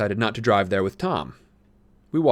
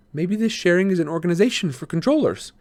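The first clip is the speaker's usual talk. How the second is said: lightly voiced boy; 9 semitones higher, 3 dB louder than usual. A narrator's reading. Tobias voiced in story.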